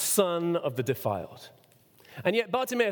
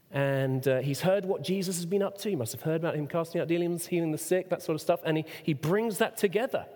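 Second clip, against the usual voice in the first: ordinary talk, nothing marked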